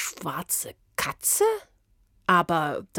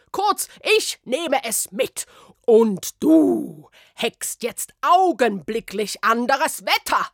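high, loud string of commands; ranting orders; 9 semitones higher, 5 dB louder than usual. Acted. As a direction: rattle off the orders furiously. (Beamtin schimpft Smyrna aus, hoch und laut)

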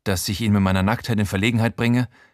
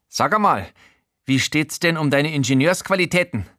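higher eager character voice; Showalter's voice; 6 semitones higher than usual. Acted. Showalter asking the narrator for help, higher eager voice.

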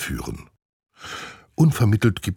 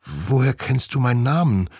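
higher, wide-eyed question; surprised chimp girl; 5 semitones higher than usual. Acted. Chimp Nina surprised at the glider knowing her name. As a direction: ask in mild surprise. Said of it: "reißt überrascht die Augen auf".

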